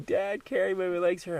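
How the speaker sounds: put-on high pleading child; whiny little kid. Little one on a hike asking dad to carry, high whine. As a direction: beg to be carried, a bit whiny. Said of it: "the little ones will be hiking".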